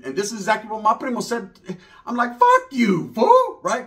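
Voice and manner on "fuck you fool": high, loud "fuck you, fool"; yelled insult. Retold or imitated. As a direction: bark it up high in his face. Yelling at the uncle.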